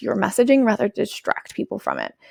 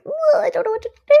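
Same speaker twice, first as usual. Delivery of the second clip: helpless voice. Retold or imitated. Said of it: "we're like".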